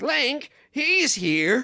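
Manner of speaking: high, slow skeleton voice; lightly voiced skeleton; Stalfos voice. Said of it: "the Stalfos is like".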